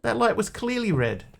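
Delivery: very high, alarmed squeal; panicked passenger. Acted. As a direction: squeak it out in disbelief. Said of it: "The guy goes".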